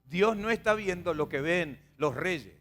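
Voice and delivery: usual speaking voice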